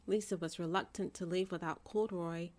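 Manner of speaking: usual speaking voice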